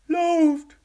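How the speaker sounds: full-throated high yell; shout. Acted. Grisby yelling at the group to run, a loud panicked shout. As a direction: scream it as the creatures swarm. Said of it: "schrie Grisby".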